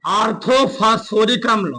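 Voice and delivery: spaced out, repeating each phrase for writing; teacher reading notes aloud word by word; slow dictation. Shown as a demonstration. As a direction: dictate slowly so they can copy.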